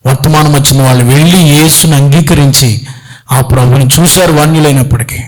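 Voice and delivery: earnest prayer voice over the congregation. Acted. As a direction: pray it out with feeling. Pastor leads closing prayer.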